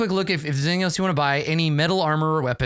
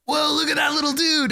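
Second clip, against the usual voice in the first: much higher, shouting in wonder; amazed whoa; 14 semitones higher than usual. Acted. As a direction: gasp at the little cat. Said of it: "they're both like".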